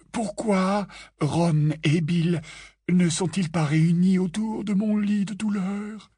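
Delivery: milk the sickbed drama, drawn out; mock-dramatic George.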